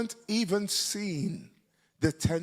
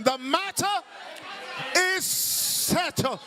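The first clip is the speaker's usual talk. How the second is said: high, emphatic African-style declaration; African preacher; 10 semitones higher, slower than usual. Shown as a demonstration. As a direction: proclaim it like an African, drawing out the ending. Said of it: "Say it like an African".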